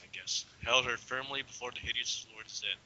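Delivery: ordinary talk, nothing marked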